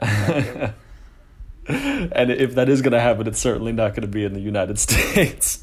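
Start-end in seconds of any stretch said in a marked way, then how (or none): 1.7-5.6 laughing. Real, not acted. Laughing at own joke.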